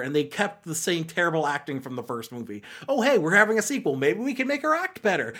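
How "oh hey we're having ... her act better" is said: quick, higher mock-eager voice; mock enthusiasm. Host imitating filmmakers planning a sequel, mock enthusiasm. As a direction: rattle it off with fake bright-idea eagerness.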